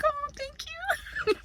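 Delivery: very high, soft and slow sugary thank you; mock sweet thanks. Thanking Victor for saving her some pizza, high sugary and slow. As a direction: gush an overdone thanks. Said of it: "I'm like".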